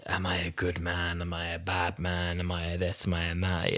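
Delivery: soft, a hint of a mock angsty hero; mocking the Doctor's angst; mock brooding Doctor. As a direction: lightly send up the self-doubt. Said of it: "it's not screaming in your face".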